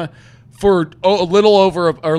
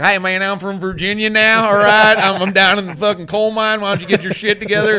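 tough Virginia guy; 6 semitones higher, 7 dB louder than usual. Retold or imitated. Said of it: "And he's like".

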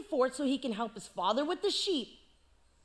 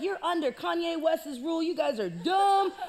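loud bragging shout; hammed-up giant boast; boastful giant; 3 semitones higher, 4 dB louder than usual. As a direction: brag at the whole army. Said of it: "talking all this mess".

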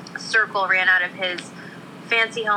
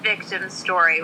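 ordinary talk, nothing marked

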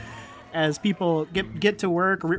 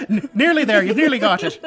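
talking through laughter, higher and louder; real laughter; 8 semitones higher, 6 dB louder, faster than usual. Real, not acted. Game master laughing along at the bat joke, laughing. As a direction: crack up while getting the words out. Table cracking up over the bat.

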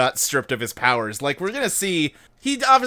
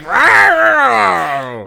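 loud mock roar, pitched up; playful monster growl; 8 semitones higher, 10 dB louder than usual. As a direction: roar it out like a cartoon beast. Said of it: "He just roars at him".

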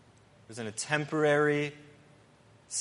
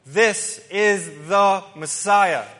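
slow, much louder and higher proclamation; grand announcement; 5 semitones higher, 12 dB louder, much slower than usual. Acted. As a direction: proclaim it to the whole world, slowly. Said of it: "God is essentially announcing".

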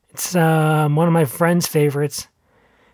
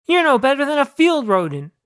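higher-pitched retort; mild yell back; 10 semitones higher than usual. A narrator's reading. Owner insulting the squirrel, mild yell back. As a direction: keep arguing, pitch raised but volume contained. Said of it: "I yelled back".

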